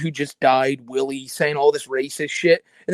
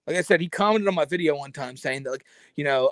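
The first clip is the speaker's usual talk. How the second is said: ordinary talk, nothing marked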